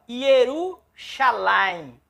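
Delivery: Yerushalayim, slow and syllable by syllable, ye-ru-sha-la-yim; Hebrew pronunciation. Shown as a demonstration. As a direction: spell out Yerushalayim slowly in Hebrew. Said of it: "E aqui nós vamos ler, olha só". (pronúncia lenta de Yerushalayim em hebraico)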